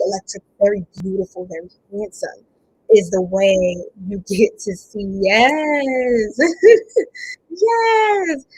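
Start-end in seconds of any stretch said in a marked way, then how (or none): 5.2-8.4 high, laughing yes. Real, not acted. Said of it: "laughing burst".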